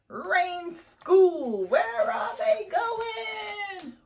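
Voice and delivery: high, loud, gleeful shout of the title; high squealing excitement; excited. Acted. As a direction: burst out with delight at the picture. Excited reader greets the cover.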